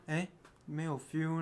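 quiet teasing imitation; soft mocking quote. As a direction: mumble it back mockingly. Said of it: "你一开始跟我讲说".

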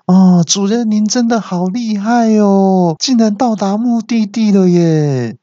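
high, gushing navigator voice; hammed-up, high-pitched flattery; fawning servant. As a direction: gush admiringly, stretching the endings. Navigator fawns over its master.

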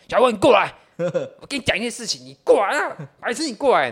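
put-on drunk friend calling him over; 醉汉叫人. 模仿醉友叫人. (醉醺醺高声招手)

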